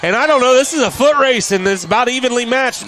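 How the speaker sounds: high, loud racing call; excited play-by-play. Real, not acted. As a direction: build the call with the runner. Breakaway touchdown run called live.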